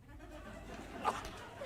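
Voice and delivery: breathy rising showman laugh; delighted host laugh. Acted. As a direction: laugh it up for the audience. Host laughs at guest's joke.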